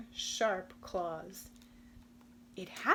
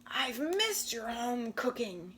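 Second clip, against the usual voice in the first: growled. Acted. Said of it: "he growled in the other ear".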